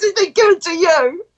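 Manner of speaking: high, mock-whiny laughing complaint; laughing protest. Friend envious of the gift, laughing mock complaint. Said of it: "He's laughing".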